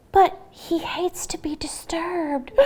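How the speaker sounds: hushed, unvoiced frightened whisper. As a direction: breathe it out in awe. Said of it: "gasped all the animals".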